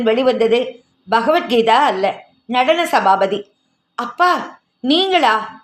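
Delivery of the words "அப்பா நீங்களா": high, startled question; surprised. A narrator's reading. Yamini finds her father, not her sister, coming out of the bathroom, startled and rising. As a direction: caught off guard, rising in surprise. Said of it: "ஆச்சரியப்பட்டு போனாள்".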